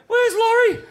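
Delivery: very high, loud frantic question; scanning the whitewater for the missing surfer, panicked; panicked call. Retold or imitated. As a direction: search the water in alarm. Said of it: "And we're like".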